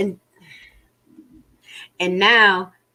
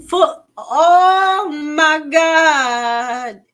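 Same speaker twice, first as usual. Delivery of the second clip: slow, high, crying wail; real despairing cry; drawn-out sung wail of dismay; anguished wail; 10 semitones higher, 8 dB louder, much slower than usual. Real, not acted. Wailing at election result.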